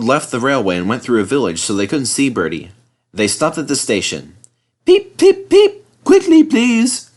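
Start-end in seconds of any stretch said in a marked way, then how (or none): 4.9-7.1 very high, loud peeping engine; peep, then urge them slow; whistling call. Acted. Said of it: "called Thomas".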